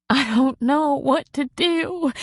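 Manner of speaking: high, slow, small tearful whimper. A narrator's reading. Marie to Patty, lost in grief, high whimpering. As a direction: let it come out as a helpless whimper. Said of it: "she whimpered".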